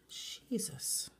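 hushed, drawn-out whisper of amazement; soft whispered exclamation; awed whisper. Real, not acted. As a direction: whisper it under your breath, stunned. Awestruck at neon blue glitter.

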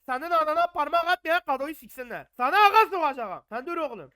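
high, loud threatening shouts; angry threats. Real, not acted. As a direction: hurl threats at the game enemy. Menace un ennemi du jeu.

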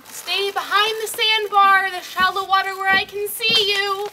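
loud, slow warning shout; calling out. Acted. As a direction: call out to kids far away. Babysitter calling to swimmers.

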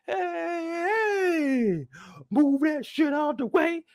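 high, slow, rowdy party voice; hammed-up dancing fool; mock hype partier shouting high; partying club-goer. Acting a guy partying.

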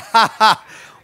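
loud, high burst of laughter; real laugh. Real, not acted. Preacher cracking up over his big Bible, loud laugh.

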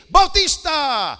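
high loud roll-call shout; calling out names. Retold or imitated. Announcer calling graduates up in the auditorium, shouting the name. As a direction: bellow the name across the hall.

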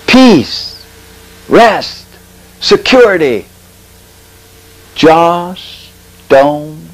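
word by word, long hanging pauses; hypnotic divine voice. Retold or imitated. Supernatural voice commands the dying preacher, hypnotic. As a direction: intone each command slowly, as if casting a spell. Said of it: "You might even say hypnotic".

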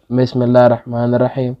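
usual speaking voice

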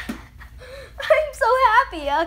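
high, slow, tearful gush; real happy sobs breaking into words; crying with joy. Real, not acted. Gamer overcome after a dream trade, crying with joy. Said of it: "I gotta stop crying".

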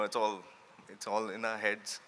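ordinary delivery

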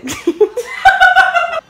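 high burst of laughter; loud laugh. Real, not acted. Laughing at basketball with roommate.

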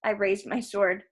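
usual speaking voice

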